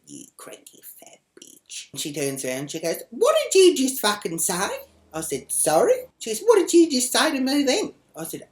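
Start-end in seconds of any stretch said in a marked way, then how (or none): 0.1-1.9 slow, whispered muttered insult; under the breath. Retold or imitated. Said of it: "under my breath I said". 3.1-4.7 loud, high mock outrage; angry confrontation. Acting out the woman confronting her. 5.5-6.1 high, loud mock-innocent question; challenging query. Her loud reply in acted quarrel. 6.4-7.9 loud, high mock outrage; angry confrontation. Woman demanding, in acted quarrel.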